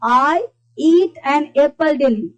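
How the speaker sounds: very slow, each word spaced out; slow model sentence. Shown as a demonstration. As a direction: say it slowly for the class to repeat. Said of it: "जैसे देखो". (धीरे-धीरे उदाहरण वाक्य)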